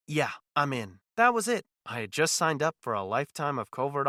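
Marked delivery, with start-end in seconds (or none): none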